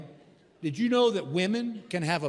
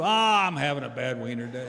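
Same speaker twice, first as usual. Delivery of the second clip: mock glum moan; 4 semitones lower than usual. Man answering Jimmy's what's wrong question, mock glum moan. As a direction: moan it like a hammed-up sufferer. Acting out a man's glum complaint.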